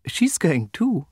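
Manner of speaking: high, slow, nervous voice; timidly, drawn out and high; trembling. A narrator's reading. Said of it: "trembling very much".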